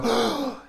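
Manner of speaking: a sharp high gasp of shock. Retold or imitated. Class reacts to the F word with a shocked gasp. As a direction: gasp like a class that heard a swear. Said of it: "you can hear the class go".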